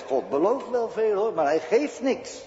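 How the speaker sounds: tempting devil. Acted. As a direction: urge him to give it up like a sly tempter. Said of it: "Die zegt".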